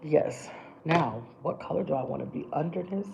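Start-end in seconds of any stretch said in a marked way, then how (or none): none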